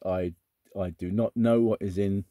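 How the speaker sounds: usual speaking voice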